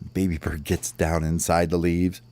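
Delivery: usual speaking voice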